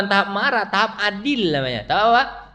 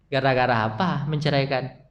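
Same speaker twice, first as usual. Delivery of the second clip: ordinary talk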